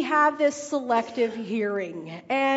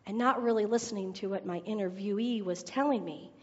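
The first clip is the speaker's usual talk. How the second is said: normal talking voice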